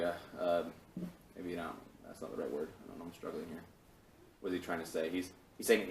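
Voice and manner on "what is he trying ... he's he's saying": normal talking voice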